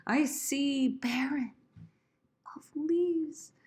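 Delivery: slow, high recital with a pause before "leaves"; lingering, lifted verse line; stretched out. Shown as a demonstration. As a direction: draw out SEE and BARREN. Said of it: "stretch that out".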